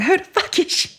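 high-pitched indignant demand; pissed off. Retold or imitated. Woman learning he loves someone else, pissed off. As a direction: pitch it way up, offended. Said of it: "I was getting pissed".

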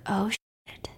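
breathy whispered oh shit; whispered curse. Retold or imitated. Captain opening the letter, a stunned whisper.

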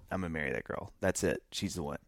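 confiding to a friend in the car; hushed vow. Retold or imitated. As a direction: say it quietly and sure. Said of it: "I said".

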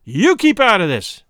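Womble shouting fiercely at the woman. Acted. Husband ordering the woman to keep out, angry shout. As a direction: bark it out, furious and loud. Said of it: "turned upon her fiercely".

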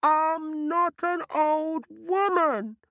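very high, innocent little voice; round hollow pumpkin voice. Acted. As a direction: squeak it like a fibbing pumpkin. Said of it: "a voice said".

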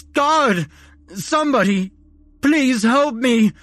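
high, loud desperate screams; a doomed man begging for his soul; screaming for help in agony. A narrator's reading. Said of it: "crying in agony as he pleaded".

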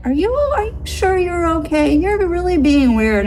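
high-pitched mock motherly concern; concerned mom. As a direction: imitate a worried mother fussing. Mom voiced, worried, in story.

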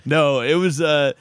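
ordinary delivery

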